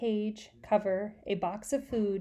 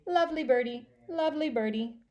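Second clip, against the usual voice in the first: higher birdlike echo; bird voice; 5 semitones higher than usual. Acted. Mynah bird repeating Grandma's praise. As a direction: parrot the words back brightly. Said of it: "Uncle Feather said".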